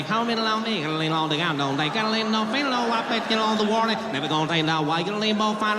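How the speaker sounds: rapid, mumbled Southern nonsense like a banjo; Southern gibberish rant. Retold or imitated. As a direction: gabble it like an arguing Kentucky man. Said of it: "the guy was like".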